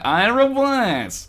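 high, very drawn-out mock announcement; hammed-up reveal of a name; put-on name announcement. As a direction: stretch the name out in a silly high voice.

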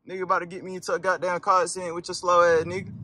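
irritated rant. Real, not acted. Slow driver nearly causes accident.

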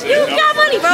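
very high, loud teasing; high teasing exclaim. Real, not acted. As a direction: tease her with a squeal. Teasing friend who claims broke.

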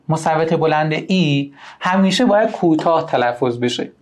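usual speaking voice

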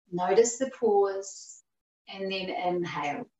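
slow, measured meditation cues; guided breathing. Acted. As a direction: pace the breath slowly, letting each cue settle. Leads paced breathing.

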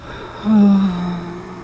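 high, drawn-out weary sigh; long exasperated sigh. Real, not acted. Player worn down by losing the zombie villagers, exasperated sigh. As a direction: let out a long sigh of defeat. Fed up after repeated losses.

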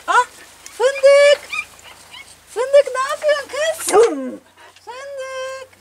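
very high squeaky pet voice; calling a pet. Real, not acted. Owner checking on the nursing mother, high-pitched calling. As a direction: call in a thin, very high coo.

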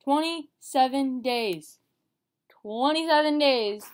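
loud, high, drawn-out proclamation; excited announcement. Real, not acted. Telling viewers the countdown, excited proclamation. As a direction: announce the total slowly and loudly.

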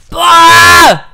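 loud, high "Boah" burst; frustrated shout. Real, not acted. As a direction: let the frustration explode. Gaming frustration outburst.

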